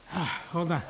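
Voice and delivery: breathless, winded sigh; gasping for air before speaking; out of breath. Real, not acted. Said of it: "Now I gotta catch my breath".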